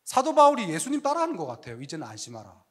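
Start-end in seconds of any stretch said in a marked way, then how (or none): 1.8-2.6 much lower and softer, 이제는 leaned on; whisper-soft calm command; gentle reassurance. Acted. Paul echoing Jesus, voiced. (아주 낮고 부드럽게 이제는 안심하라)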